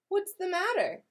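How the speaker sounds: put-on a mom checking on her child; concerned mother. Ma asking sad Grace what's wrong, caring question.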